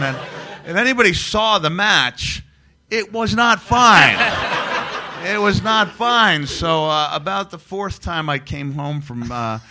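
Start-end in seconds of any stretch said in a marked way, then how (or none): 2.9-6.5 loud, slow, higher "it was not fine"; emphatic. Real, not acted. Comedian landing the punchline to the podcast host, emphatic and loud.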